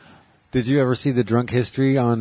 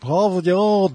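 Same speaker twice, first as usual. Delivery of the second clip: high British rocker doing Boston vowels; 8 semitones higher than usual. Acted. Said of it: "What if he, what if [name] said he's parking cars".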